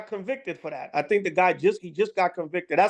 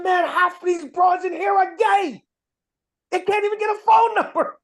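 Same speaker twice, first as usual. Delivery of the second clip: much higher, shouted mock voice; imitating coworker; 10 semitones higher, 4 dB louder than usual. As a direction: ham up Esau's shout. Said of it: "He said".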